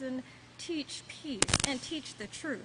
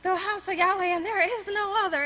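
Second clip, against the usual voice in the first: imitating a man proclaiming, higher and louder; man's proclamation; 7 semitones higher, 5 dB louder than usual. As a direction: proclaim it like the voice that wakes you up. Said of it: "I hear this man saying".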